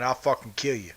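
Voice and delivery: usual speaking voice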